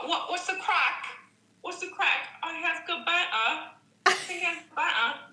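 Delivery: high, mock British reality-show accent; Love Island accent. Imitating British contestants from trash reality shows, high Love Island accent. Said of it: "Love Island accents".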